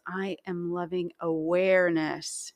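slow, with "awareness" stretched out; emphasize "awareness" as the key word; stress on AWARENESS. Shown as a demonstration. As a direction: land deliberately on a long "awareness". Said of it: "on the last one".

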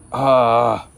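loud, frustrated "ah"; exasperated groan. Real, not acted. Host fed up with mosquitoes, exasperated groan. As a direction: groan out real irritation.

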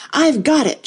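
high triumphant cry. A narrator's reading. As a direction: announce the catch in mild triumph. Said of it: "cried in a triumphant tone".

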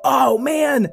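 plain reading voice

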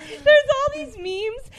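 high, squeaky attempt to talk through giggles; laughing too hard. Real, not acted. Host trying to explain memes, cracking up. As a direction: push the words out through laughter.

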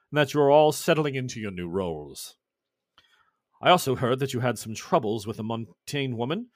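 stately, measured reading; formal letter read. Acted. Messenger reads Lord Holbein's scroll to the crew, formally.

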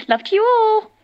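bright sign-off. Acted. Sign-off of voice note.

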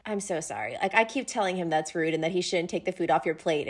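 low, soft apologetic murmur; quiet apology. Acted. Voicing Azriel apologizing at dinner.

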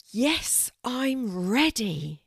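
high, slow reply after a big breath; deep breath first. A narrator's reading. Kelty answers the tree after a deep breath, steeling herself. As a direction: brace yourself, then answer slowly and high. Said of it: "Taking a deep breath".

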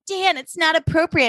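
prim imitation. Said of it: "Rachel's like".

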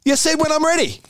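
high, whiny mock protest of a young player; high mock-petulant no no no; cheeky refusal. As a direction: play the stubborn kid refusing to go on. Fev refusing coach, retold.

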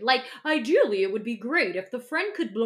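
ordinary delivery

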